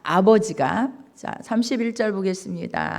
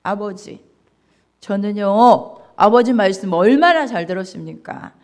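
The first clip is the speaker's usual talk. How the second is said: rising, louder angry complaint; protesting son; 5 dB louder, faster than usual. Acted. Elder son angrily protesting to his father, building up. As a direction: argue back, building to a near shout. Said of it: "이렇게 따지는 거예요".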